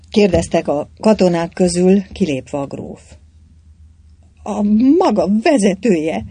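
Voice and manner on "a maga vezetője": tearful, furious outburst, louder; angry crying. A narrator's reading. Anna cries out in anger at the soldiers, tearful fury. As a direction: spit it out in angry tears. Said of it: "sírta dühösen a leány".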